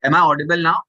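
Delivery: speaking up on a weak line. Real, not acted. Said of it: "Yeah, better".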